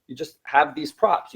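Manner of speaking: usual speaking voice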